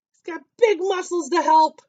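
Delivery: high, loud, put-on cheering voice; hearty boast. A narrator's reading. Reader showing off toad's muscles, hearty boast. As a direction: belt it out with showy strength. Voicing the toad's big strength.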